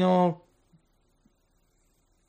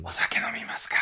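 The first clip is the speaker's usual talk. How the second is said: whispered, secretive offer; hushed voice; 7 dB quieter than usual. Retold or imitated. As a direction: breathe it like a secret. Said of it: "あの、小声でさ。".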